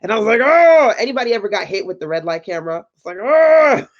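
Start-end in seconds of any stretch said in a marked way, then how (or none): 0.4-0.9 loud, high re-enacted "oh"; mock exasperated groan. Driver caught by red light camera, hammed-up groan. 3.2-3.9 high, loud groan of replayed dismay; mock exasperated groan. Retelling the camera flash to viewers, exaggerated groan.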